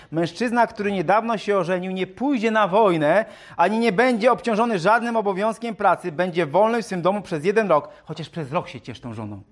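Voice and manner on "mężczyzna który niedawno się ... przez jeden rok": high, emphatic reading voice; scripture reading. Acted. Preacher reads the law about newlywed men, scripture reading. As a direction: proclaim the written law to the room.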